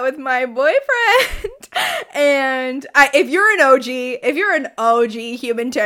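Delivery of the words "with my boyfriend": loud, drawn-out giddy delight; gushing and laughing, higher and loud; weird giddy sound. Real, not acted. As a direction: stretch it out through a laugh. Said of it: "weird ass sound every time I say my boyfriend".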